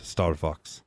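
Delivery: Starvox with the V said as an F; German pronunciation. Shown as a demonstration. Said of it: "the V is a F sound".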